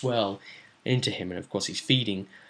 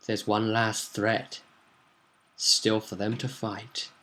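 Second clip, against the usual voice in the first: slow, hushed suspense; ominous teaser; 2 semitones lower, 6 dB quieter, slower than usual. Acted. Storyteller reveals one last threat, slow and ominous. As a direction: lay it out slowly and softly.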